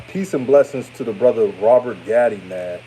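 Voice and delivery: normal talking voice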